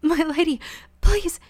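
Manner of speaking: terrified maid begging her mistress; desperate plea. Acted. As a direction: beg for mercy as the blows land. Beaten servant begging.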